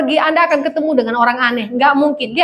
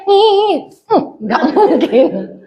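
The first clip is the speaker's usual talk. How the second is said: wife's doubt; 3 semitones higher, much slower than usual. Retold or imitated. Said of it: "Istri saya".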